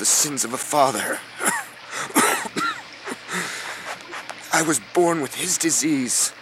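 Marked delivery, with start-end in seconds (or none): 1.2-4.5 sick cough. Acted.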